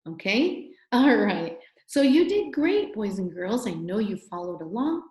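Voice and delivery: normal talking voice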